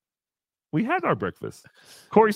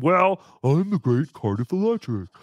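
mock pompous friend; 3 semitones lower than usual. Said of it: "Cardiff decided".

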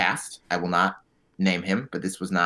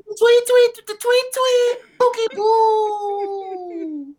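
bird voice; 4 dB louder, slower than usual. Pretend. Imitating bird tweets.

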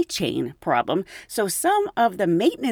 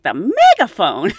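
disbelieving echo, louder; incredulous; 7 dB louder than usual. Retold or imitated. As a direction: say it like you can't believe it. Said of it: "And I'm like".

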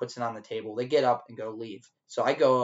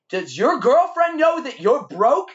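taunting yell; 11 semitones higher, 6 dB louder than usual. Retold or imitated. Waiter chasing the broke customer, yelling a taunting insult. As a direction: shout it to humiliate him in front of his girl. Running toward him taunting.

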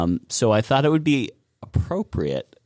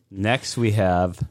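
ordinary delivery

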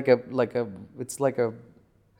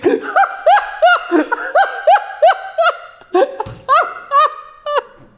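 long, high-pitched laughing fit; helpless laughter; 6 dB louder than usual. Real, not acted. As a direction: crack up loudly and keep going. Laughing at picture of bikers.

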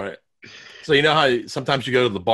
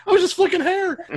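high defensive squeak. Retold or imitated. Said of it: "You're like".

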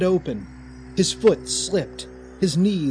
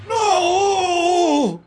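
very high, loud desperate cry; 7 dB louder than usual. A narrator's reading. Raymond seeing Elizabeth jolted, desperate cry. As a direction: cry out in despair. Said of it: "Raymond cried".

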